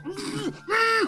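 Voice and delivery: high wordless muffled protest; muffled kid's squeal. Acted. Kid with Mom's hand over his mouth, muffled squealing. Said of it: "you hear him just go".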